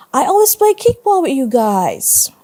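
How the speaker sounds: high-pitched, puzzled protest; confused protest. A narrator's reading. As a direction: protest as a kid who can't understand being left out. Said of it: "looking confused".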